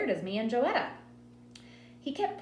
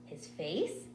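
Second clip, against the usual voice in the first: ordinary delivery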